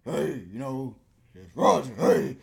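a loud, hammed-up tough guy greeting. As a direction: puff up and sound big on the phone. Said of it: "Sometimes you gotta sound like".

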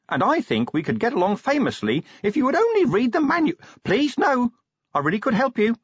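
high-pitched, eager little imp voice; pleading imp. Acted. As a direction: plead your case as a small imp.

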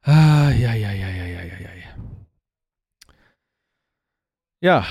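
satisfied sigh after drinking; after a sip. Real, not acted. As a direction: ahh after a swallow. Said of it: "Ich muss noch mal einen Schluck nehmen".

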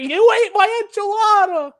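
very high-pitched accusing cry; Crazy Steve. Retold or imitated. Accusing someone over a stolen enchilada, high manic impression. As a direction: shriek the accusation like a crazed character. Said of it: "And also, he's like".

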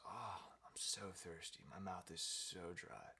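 slow, dragged-out lizard complaint; parched stoner. Acted. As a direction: drag it out, stoned and thirsty.

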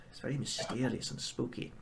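hushed spooky whisper. Acted. Farmer confiding the ruins' secret, in a whisper. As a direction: whisper it like a ghost story.

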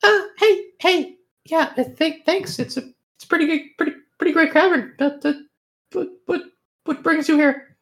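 high, fast little bat voice. Acted. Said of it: "the bat stops and says".

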